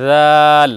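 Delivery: জাল said softly held; soft letter. Shown as a demonstration. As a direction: soften জাল. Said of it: "আমরা নরম করে উচ্চারণ করব". (জাল নরম করে)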